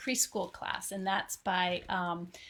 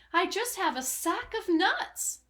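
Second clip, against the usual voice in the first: squirrel reply; 8 semitones higher than usual. Acted. Storyteller voicing the squirrel answering the rat, high and timid. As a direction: pipe it up like a small squirrel. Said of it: "the squirrel replied".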